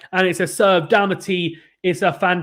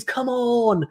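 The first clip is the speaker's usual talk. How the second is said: pitched-up mock yell of Come on!; imitated scream; 8 semitones higher than usual. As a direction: echo the player's fist-pump cry, up high. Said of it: "And he screams".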